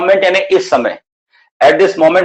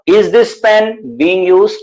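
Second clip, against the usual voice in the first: very slow, each word set apart; slow and clear; 5 semitones higher, much slower than usual. Shown as a demonstration. Teacher modelling a sentence for students, very slow. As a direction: model it slowly for the class. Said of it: "पहले धीरे बोलो please".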